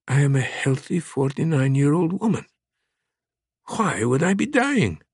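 a woman asking why she'd be dying, pitch climbing; voiced frightened woman. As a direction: narrator voicing a woman's disbelief. Woman voiced in thriller narration.

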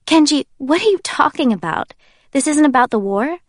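high, puzzled questioning; trying to make sense of it; confused. Acted. Jay asks Kenji what he means, high and confused.

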